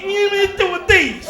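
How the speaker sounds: high, loud, slow drawn-out order; mock loud customer. Comic imitating audience member ordering during show, mock bellow. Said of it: "he ordering with his fingers".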